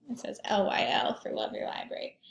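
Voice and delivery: whispered aside about the shirt. Real, not acted. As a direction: breathe it out in a whisper.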